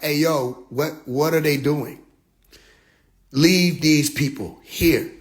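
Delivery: an unimpressed visitor from another galaxy; disdainful alien. Retold or imitated. Extraterrestrial telling the man to leave the humans, slow and disdainful. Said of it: "the extraterrestrial got in touch with him and was like".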